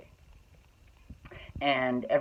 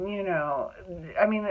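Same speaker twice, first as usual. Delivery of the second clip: usual speaking voice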